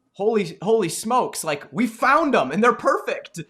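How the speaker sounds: high, loud amazed exclamation; thrilled, disbelieving delight; excited. Retold or imitated. Clients amazed a month later.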